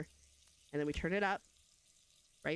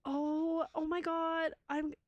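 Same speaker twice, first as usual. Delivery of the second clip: much higher and louder, a delighted gasp of oh my God; overjoyed surprise; 10 semitones higher, 4 dB louder, slower than usual. Acted. Mother seeing son in his late father's glasses, delighted shriek. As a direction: burst out in delighted shock.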